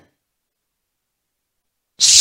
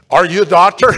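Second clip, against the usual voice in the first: louder, anxious rising question; nervous question; 3 semitones higher, 9 dB louder, faster than usual. Retold or imitated. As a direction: ask it afraid of the answer. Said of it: "I was afraid to ask".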